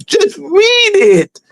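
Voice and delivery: high, slow, drawn-out just read it; mock exasperated voice. To parroting followers, slow and high exasperated plea. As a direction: mimic a fed-up teacher, stretched and high. Imitating someone urging others.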